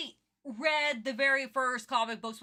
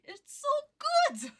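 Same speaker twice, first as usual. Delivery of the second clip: laughing; 4 dB louder, slower than usual. Real, not acted. Gushing about Spawn with a laugh.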